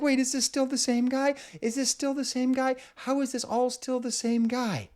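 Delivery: high, clear sing-song voice. Retold or imitated. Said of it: "And you're like".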